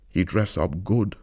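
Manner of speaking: ordinary delivery, nothing marked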